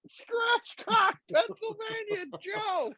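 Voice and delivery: high-pitched incredulous exclamation; mock disbelief. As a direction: repeat it back to Joe in squeaky disbelief. Acting out his disbelief to Joe.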